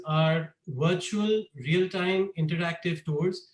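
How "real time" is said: normal talking voice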